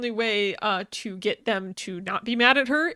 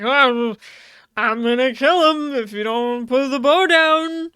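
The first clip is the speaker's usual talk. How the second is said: mock villain threat; 6 dB louder than usual. Retelling the career guy's threat.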